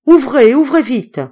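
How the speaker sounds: high, loud urgent shout; hoarse shouting. A narrator's reading. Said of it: "voix rauque qui criait".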